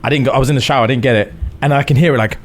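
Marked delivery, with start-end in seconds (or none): none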